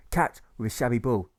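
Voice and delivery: ordinary delivery, nothing marked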